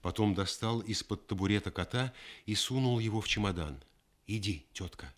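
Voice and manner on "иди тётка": softly, barely above a hush; quiet. Acted. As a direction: say it gently and quietly. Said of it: "сказал он тихо".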